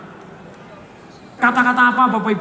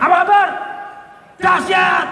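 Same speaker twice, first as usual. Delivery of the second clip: excited call and answer; 7 semitones higher than usual. Shown as a demonstration. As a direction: hype it up like a crowd chant. (seruan semangat dan keras)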